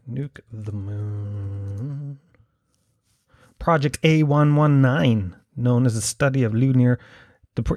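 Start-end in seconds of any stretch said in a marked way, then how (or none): 0.1-2.2 very slow, soft, drawn-out words; murmured to oneself while looking something up; absent-minded murmur. Real, not acted. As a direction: stretch it out low and quiet while searching. Murmurs the words while googling them.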